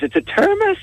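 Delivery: very high, proud announcement; proud new owner. Acted. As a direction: show off the thermos. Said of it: "And he goes".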